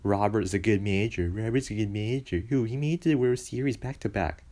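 mocking Roberts defenders. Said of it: "I only hear".

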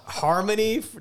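can't stop laughing while telling it; amused laughter at the happy ending. Real, not acted. Laughing at the gang brawl story.

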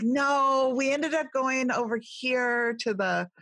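high, exaggerated California accent imitating mom; Californians-style mom. Retelling mom's freeway directions, in a high Californian accent. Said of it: "we sound like an episode of The Californians".

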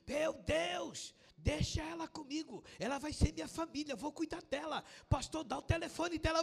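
acted overjoyed cry, high and loud; excited outburst. Brother on the phone welcoming a new believer, excited outburst. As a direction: play an excited man exclaiming down the phone.

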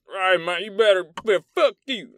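high, loud mock-hostile outburst; hammed-up aggressive bluster; belligerent. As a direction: mimic a brusque local picking a fight. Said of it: "they're just belligerent".

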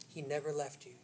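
soft, low, with long pauses; tender reassurance. Real, not acted. Preacher assuring congregation God never left, hushed tender reassurance. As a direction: let each assurance settle quietly.

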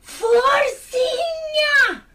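slow, drawn-out delighted squeal; savouring the scandal with a laughing cry; gleeful gasp. Acted.

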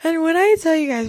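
laughing. Real, not acted. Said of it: "I gotta stop laughing".

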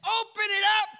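loud, high command; Jesus ordering the tomb opened; commanding shout. Acted.

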